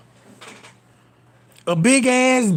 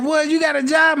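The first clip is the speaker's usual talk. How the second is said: put-on higher, disbelieving girl voice; surprised girlfriend; 7 semitones higher than usual. Girlfriend hearing he works, mock surprised. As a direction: she can't believe he has a job. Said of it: "She was like".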